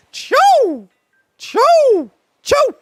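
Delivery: piercing high attack yell, very loud; fighting game character attack shout; Assassin attack cry. Retold or imitated. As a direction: spit out sharp high choo cries. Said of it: "I would just go".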